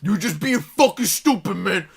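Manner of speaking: retold father arguing at full volume; imitated yelling dad. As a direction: play the dad demanding an explanation. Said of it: "like, a yelling match at each other".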